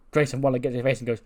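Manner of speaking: ordinary talk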